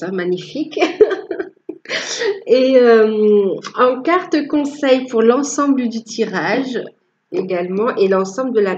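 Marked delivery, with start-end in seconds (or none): 0.8-2.4 laughing at herself. Real, not acted. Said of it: "Je rigole moi-même de mon accent".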